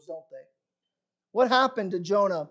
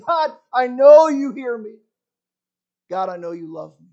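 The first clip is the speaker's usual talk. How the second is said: earnest prayer; 2 semitones higher, 7 dB louder than usual. Retold or imitated. Jonah praying to God from the belly, earnest. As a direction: cry it out with faith.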